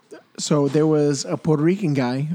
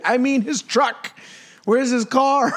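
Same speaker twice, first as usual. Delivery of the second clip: panicked; 8 semitones higher, slower than usual. Retold or imitated. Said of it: "He's like".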